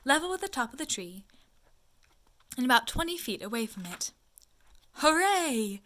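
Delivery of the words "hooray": high, loud cheer; a happy loud hooray; shout. A narrator's reading. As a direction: call out in delight. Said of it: "you shouted".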